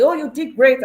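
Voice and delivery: high, loud congratulation; praising onlooker. Retold or imitated. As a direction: imitate someone cheering you on. Voices a person praising her.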